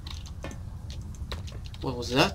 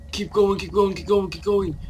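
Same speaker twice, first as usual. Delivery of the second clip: ordinary delivery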